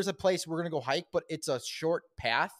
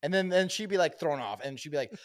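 ordinary delivery

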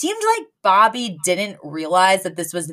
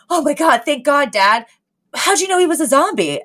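relieved gasp; 7 semitones higher than usual. Retold or imitated.

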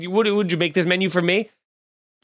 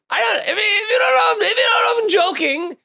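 shouting; 12 semitones higher than usual. Real, not acted. Comic shouting back at angry Disney fans on Twitter. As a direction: yell it at the critics online.

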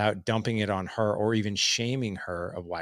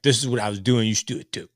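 louder start, mock preachy husband; mock pushy; 2 semitones higher, 6 dB louder, faster than usual. Husband telling his wife what she should do, mock pushy. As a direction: imitate someone pushing advice on a partner. Contrasting pushy sharing with humble sharing.

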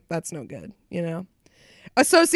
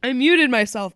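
ordinary talk, nothing marked

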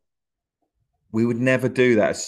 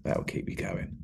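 much softer, low-voiced mentor; quoted advice; 4 semitones lower, 8 dB quieter than usual. Quoting an old comic's advice in a soft low voice. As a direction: hush it like sage advice. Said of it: "I just remember him saying".

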